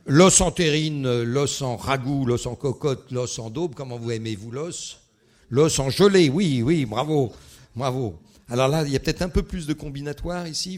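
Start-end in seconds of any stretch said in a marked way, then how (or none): 0.1-3.7 loud, slow-starting recital of clues; emphatic, projected quizmaster list; quiz clue announcement. Shown as a demonstration. Reads riddle clues aloud. 5.5-8.2 very loud, excited confirmation; quizmaster's pleased bravo, projected; congratulating a winner. Real, not acted. Confirms answer, congratulates audience.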